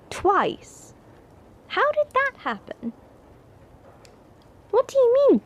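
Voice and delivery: can't believe what she just heard; shocked disbelief. Acted. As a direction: pitch jumps way up in shock. Shocked at house burnt twice.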